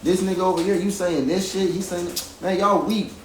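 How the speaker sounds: high, fast, heated complaint, retold; angry rant at the group, high and fast; furious rant. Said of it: "I was furious".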